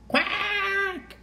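high, drawn-out quack; duck quack. Acted. Storyteller voicing the quack blown out of the duck, high quack.

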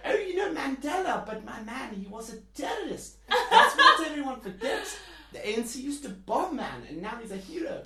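put-on loud, high South African accent through laughter; mock the old expats' line in a thick South African voice. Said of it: "can I do my accent?".

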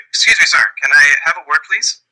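lightly acted, high polite request; polite inquirer. Player approaches stagehand in character.

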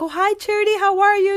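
high, lively re-enacted greeting; cheerful phone reply. Adoptive mother greets her social worker, acted cheerful hello.

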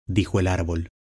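plain reading voice

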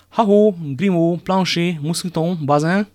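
high, loud, drawn-out call; shouted summons. A narrator's reading. The musketeers call for their servants, shouting. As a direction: holler each name to fetch them. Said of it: "kiáltották minden négyen az inasukért".

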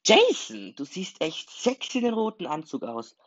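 high, loud delighted exclamation; laughing tease. A narrator's reading. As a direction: call it out high, teasing a friend. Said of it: "lachte Sarah". (neckisch und begeistert, hohe Stimme)

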